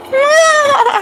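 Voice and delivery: high short laugh. Real, not acted. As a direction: laugh at the neigh. Horse neighed.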